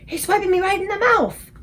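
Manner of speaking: high, loud angry yell. A narrator's reading. Woken giant yelling about a punch, loud outrage. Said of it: "yelled the meat dripper".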